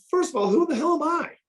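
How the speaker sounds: very high-pitched self-deprecating question; incredulous. Real, not acted. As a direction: ask it in high, amazed disbelief. Humble disbelief at being asked.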